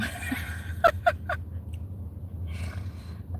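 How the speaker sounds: breathy high-pitched cackle of glee; jeering laugh at the news of her jail sentence; high wheezing laugh. Real, not acted. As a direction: laugh gleefully at her downfall.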